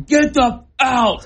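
high, drawn-out yell; retold scream. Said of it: "I'm just screaming".

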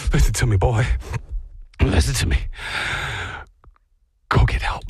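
low, forceful strained command with pauses; injured owner's plea. Acted. Fallen owner sending the dog for help, strained urgency. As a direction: act hurt, pushing out each order.

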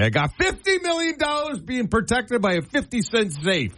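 hammed-up imitation of an old coach's quip; quoting the old line coach. Former lineman quoting his old coach to the host, mock high voice. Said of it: "He'd say".

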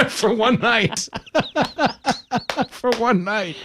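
high, slow, swooning camp lilt; campy for one night. Pretend. Host camping it up about his dinner, high and drawn out. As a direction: ham it up like a starstruck date.

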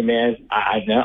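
ordinary delivery

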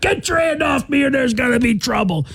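mock shout in a high voice; hammed-up, high-pitched angry outburst; belligerent drunk threat. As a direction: act out a belligerent passenger yelling a warning. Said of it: "The dude's like".

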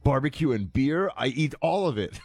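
quoting the tourist. Retold or imitated. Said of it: "I won't do his accent, but he's like".

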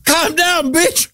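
very high squeaky joke voice; funny voice. Acted. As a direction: squeal it in a silly, sky-high pitch. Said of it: "That's a funny voice".

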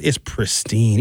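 ordinary delivery

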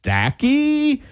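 Stacky rising high as a puzzled question; doubtful question. Retold or imitated. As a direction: ask Stacky in disbelief, pitch climbing. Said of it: "say it like a question mark".

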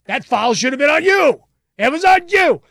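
loud, high heckle from the stands; fan yelling. Retold or imitated. Fan accusing a player of the foul, yelling. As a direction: shout the accusation like a fired-up fan. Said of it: "the fan just yells".

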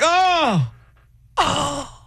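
high fake interest; exasperated groan. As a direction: sing it out, annoyed. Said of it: "I'm like".

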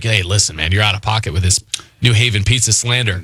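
deep caller voice. Retold or imitated. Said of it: "being like".

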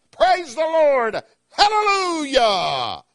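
high, drawn-out shout of praise; exultant praise cry. Acted. Preacher voicing heaven's praise to the congregation, exultant drawn-out cry.

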